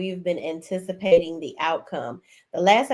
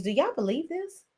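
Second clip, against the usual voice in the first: higher, softer questioning imitation; Jefferson quoting; 8 semitones higher, 5 dB quieter than usual. Retelling the pastor asking the ladies, higher softer voice. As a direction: mimic a pastor polling the room. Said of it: "Jefferson asked the ladies".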